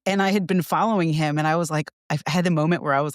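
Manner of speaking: ordinary talk, nothing marked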